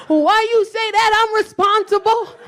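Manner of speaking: high, drawn-out protest; hammed-up offended whine; acted indignant protest. Acted drunk protest to boyfriend.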